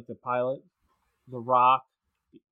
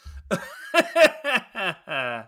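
long real chuckling laugh; rolling burst of amusement; 5 semitones higher than usual. Real, not acted. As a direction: laugh freely at your own joke. Laughs after the petting joke.

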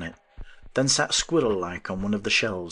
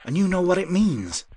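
higher, rising with eager feeling; 10 semitones higher, 4 dB louder than usual. A narrator's reading. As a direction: let the voice climb high. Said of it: "Again his voice rose".

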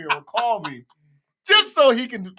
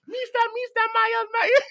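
very high imitation of a radio caller; Julie. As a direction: pipe it up high, calling over the radio. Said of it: "how would Julie call you on the radio?".

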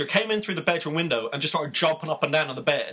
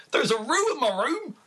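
put-on kid screaming; 10 semitones higher than usual. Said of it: "The kid screamed out".

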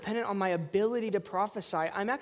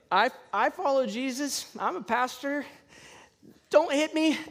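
high, halting, awkward admission; hammed-up sheepish nerves; nervous confession; 5 semitones higher, 3 dB louder than usual. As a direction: blurt it out as if bracing for trouble. Nervously introducing himself to the gym worker.

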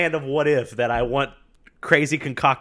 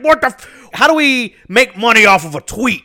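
mock boss, high and loud; CEO voice; 7 semitones higher, 5 dB louder than usual. Co-host as clueless boss eyeing tweet profits, mock CEO voice. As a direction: do a hammed-up CEO, pitched well up. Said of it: "That's, that's my C E O voice".

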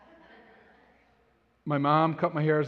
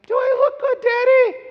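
put-on very high little boy voice; a hopeful four-year-old asking; high, loud mock toddler voice; imitating small child; 10 dB louder than usual. Dad voicing his small son.

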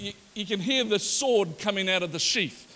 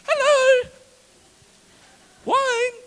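high bright fake-cheery hello; mock cheerful greeting; 7 dB louder, much slower than usual. Cupbearer to the king, forced cheerful high greeting.

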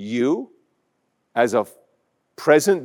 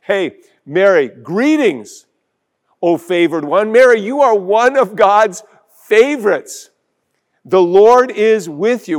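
louder, higher heralding angel; angel's joyful greeting; 4 semitones higher, 6 dB louder than usual. Acted. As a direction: announce it like a glad messenger. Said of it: "the angel shows up and says".

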